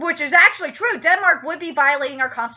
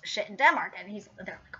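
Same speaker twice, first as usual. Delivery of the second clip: ordinary delivery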